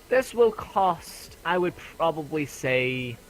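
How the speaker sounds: high, slow, drawn-out price quote; high merchant lady, hedging with long pauses; merchant woman. Acted. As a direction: work out the price slowly in a high shopkeeper voice.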